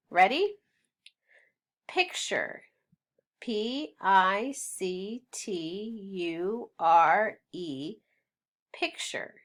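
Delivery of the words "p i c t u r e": slow, letter-by-letter spelling; measured classroom spelling. Shown as a demonstration. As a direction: give each letter its own beat. Spelling a word for learners.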